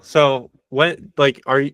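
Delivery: usual speaking voice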